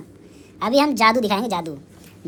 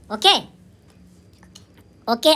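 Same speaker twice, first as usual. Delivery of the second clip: ordinary delivery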